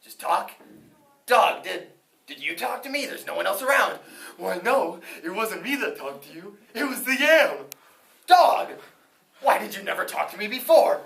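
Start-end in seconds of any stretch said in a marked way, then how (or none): 1.3-4.0 alarmed farmer. Acted. Frightened farmer asks dog. 4.4-7.7 talking dog. Acted. Dog answers farmer. 8.3-11.0 indignant farmer. Acted. Farmer confronts dog.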